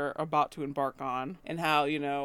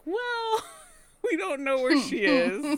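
laughing Peter quote; 11 semitones higher, 5 dB louder, slower than usual. Retold or imitated. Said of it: "And Peter's like".

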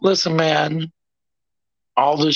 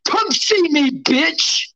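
pitched-up trash talk yell; high shouting; 7 semitones higher than usual. Real, not acted. Fighter's trash talk promo.

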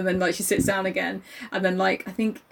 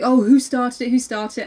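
uncle intervening; 4 semitones higher, 6 dB louder than usual. Retold or imitated. Uncle breaking up the fight.

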